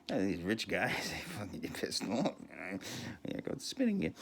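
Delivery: ordinary talk, nothing marked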